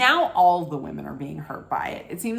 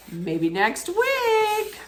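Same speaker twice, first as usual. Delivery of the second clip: hopeful; 14 semitones higher, much slower than usual. Real, not acted. Hoping Carolina falls next week.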